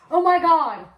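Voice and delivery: hammed-up burst of recognition; mock surprise. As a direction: imitate her sudden excitement. Quoting coworker's excited reaction.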